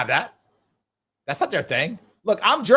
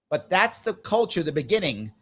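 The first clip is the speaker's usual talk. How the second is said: normal talking voice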